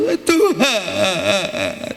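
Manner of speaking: slow, drawn-out weak sigh to God; timid plea. Retold or imitated. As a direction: sound helpless. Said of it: "Bukan masalah langsung". (keluhan lemah yang diseret)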